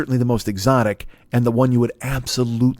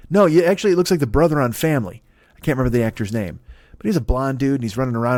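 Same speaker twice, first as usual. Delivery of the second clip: ordinary talk, nothing marked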